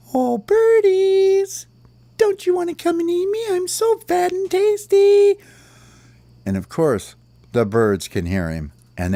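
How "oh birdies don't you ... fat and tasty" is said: very high, loud singsong lure; taunting worm call. Acted. Worm lures birds down from the stick, high teasing call. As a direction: call out in falsetto, stretching the words. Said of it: "and he goes".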